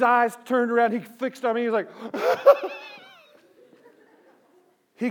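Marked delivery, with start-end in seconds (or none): 2.2-3.0 high, loud hitching wail; mock kid's panicked sobbing breaths; imitated child's gasping sob. Imitating frightened child spotting dad.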